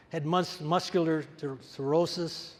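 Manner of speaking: normal talking voice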